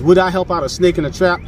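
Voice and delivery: ordinary delivery, nothing marked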